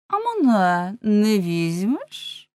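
slow, swooping, drawn-out question; stout girl. A narrator's reading. Plump girl offering herself, slow swooping voice. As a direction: swing the pitch up and draw it out. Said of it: "запитала дівка в тілі".

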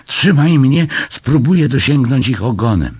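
high, loud rescue order; urgent command. A narrator's reading. Mother in floodwater telling her son to hold her, urgent command. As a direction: call out orders in an emergency.

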